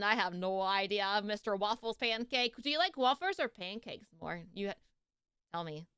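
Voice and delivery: usual speaking voice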